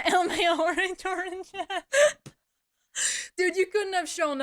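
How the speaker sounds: high, giggly read-out; laughing while reading. Real, not acted. Streamer reading chat's reaction, laughing. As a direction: break up laughing mid-phrase.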